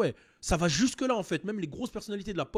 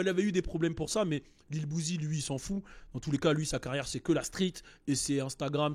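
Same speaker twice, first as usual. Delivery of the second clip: usual speaking voice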